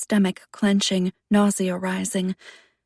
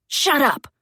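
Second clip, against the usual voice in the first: shouting; 7 semitones higher, 4 dB louder than usual. Acted. Boy yells at taunting girl.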